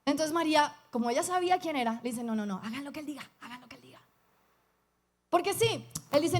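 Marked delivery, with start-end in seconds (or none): 2.2-4.0 quiet, quick hush, fading almost to nothing; hushed urging. Retold or imitated. Mary quietly tells the servants to do what Jesus says, hushed and fast. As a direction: press it softly and quickly, like a secret.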